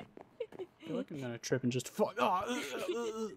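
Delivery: hammed-up nervous stutter; mock panic. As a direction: wobble like you're about to fall. Afraid of tripping on stairs.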